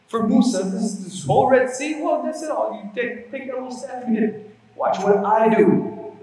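forceful preaching. Acted. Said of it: "Allah says".

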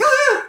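very high, short shriek; startled mock scream. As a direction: yelp as if jumped by a voice. Tim is startled by the voice.

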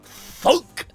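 high, loud comic-book impact noise; exclaimed sound effect. A narrator's reading. Narrator voices the knife hitting Jason, exclaimed high and loud.

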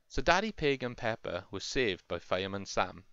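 ordinary delivery, nothing marked

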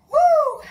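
very high, loud, held "woo"; whooping cheer. Real, not acted. Instructor spurring class through rope skipping, high loud whoop. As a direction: whoop loudly to fire everyone up.

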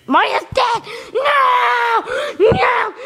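high shouted cries of despair; anguished shout. Real, not acted. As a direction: yell it out in disbelief. Character dies in game.

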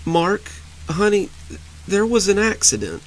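easing him into terrible news; gentle bad news. Wife breaking bad news.

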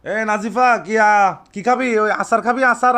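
ordinary talk

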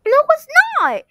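yelled high-pitched no; shrill protest. Acted. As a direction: shout it up high in outrage. Acted quarrel, protesting.

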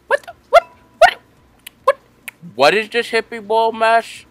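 squeaky high disbelieving cry; shocked. Real, not acted. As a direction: yelp it in shock. Sudden surprise in game.

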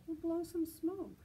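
a mother cheerfully nudging; mother's voice. Acted.

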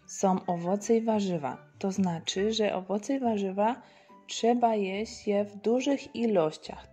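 ordinary talk

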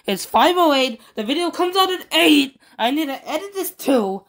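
venting under deadline pressure; worked up. Real, not acted. Youtuber panicking about the clock to himself, worked up. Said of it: "Sorry, I'm a bit worked up".

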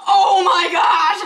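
loud slow delighted exclamation laughing; loud laughing cry of delight; delighted laughing shout. Real, not acted. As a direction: gush loudly over the bags. Delighted by colored trash bags.

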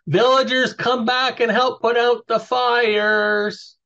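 high, drawn-out call to distant villagers; shouted summons. Acted. Calling villagers back to put out fires, shouted summons. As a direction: call out slowly, high and sustained. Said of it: "I'm gonna shout at the, my loudest voice".

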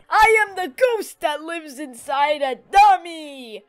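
slow, eerie ghost voice; haunt them from inside the dummy; lightly spooky ghost. Acted.

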